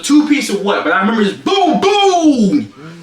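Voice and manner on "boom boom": two booms, the second long and high; exaggerated impact sound effects; double punch noise. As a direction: voice a two-piece combo landing. Imitates two punches landing.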